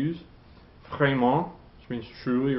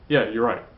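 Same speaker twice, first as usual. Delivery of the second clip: firm, louder statement falling on "right"; non-rising agreement; 5 dB louder than usual. Shown as a demonstration. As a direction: agree outright, dropping the pitch on "right". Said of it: "it's like you're saying".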